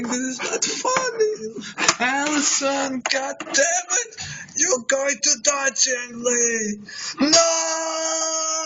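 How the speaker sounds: high, crying shouts of rage; anguished shouting. Acted. Man rages at Chang Lee over Allison's death, crying shouts. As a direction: scream at the killer through tears.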